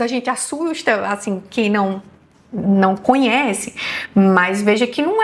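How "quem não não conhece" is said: ordinary delivery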